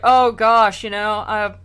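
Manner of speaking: mock dismay. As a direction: fake concern with a sigh. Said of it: "Virgil is, like, just like".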